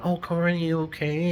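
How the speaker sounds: high-pitched mimicked check-in; mock concern. Mimicking someone checking on her.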